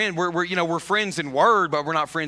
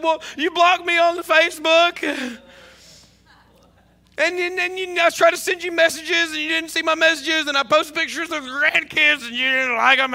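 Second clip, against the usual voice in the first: higher, louder, wounded retelling of her complaint; hurt complaint; 11 semitones higher, 4 dB louder than usual. Retold or imitated. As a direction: voice the friend's hurt, raised and pained. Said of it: "she was devastated".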